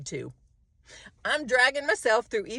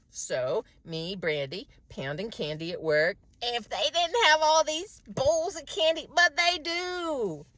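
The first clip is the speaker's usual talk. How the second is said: ordinary delivery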